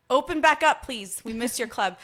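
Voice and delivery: pleading shout; excited loud plea. Real, not acted. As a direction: call out loud, begging them to reopen. Shout out plea to closed club.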